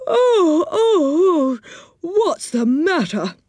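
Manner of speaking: high, slow pretend-drowsy moan; sleepy voice. Moonface faking sleep for What's-His-Name, high and drowsy. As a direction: fake just waking up. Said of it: "said Moonface in a sleepy voice".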